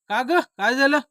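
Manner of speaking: high, loud questioning yell; loud shout. Acted. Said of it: "एवढं मोठ्यानं ओरडलं". (मोठ्याने ओरडून विचारणे)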